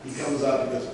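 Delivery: normal talking voice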